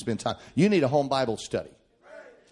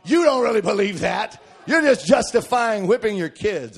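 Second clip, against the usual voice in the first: put-on raised, accusing voice of skeptical kids; louder, higher mock protest; 6 semitones higher, 5 dB louder than usual. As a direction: imitate kids calling out a parent. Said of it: "your kids are saying".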